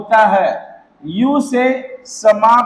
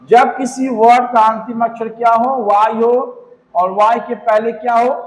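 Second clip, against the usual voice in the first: normal talking voice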